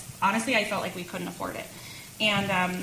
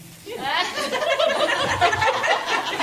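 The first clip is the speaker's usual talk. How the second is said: high laughter; 7 dB louder than usual. Real, not acted. Laughing at husband's answer.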